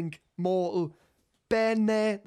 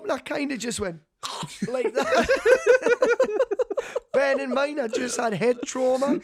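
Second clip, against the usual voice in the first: high laughing voice; can barely get it out for laughing; 6 semitones higher, 5 dB louder than usual. Real, not acted. Laughing while telling it.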